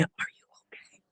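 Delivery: hushed, breathy worried question; a man whispering his concern to a stranger; imitating a whisper. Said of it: "I don't know why I'm whispering like this".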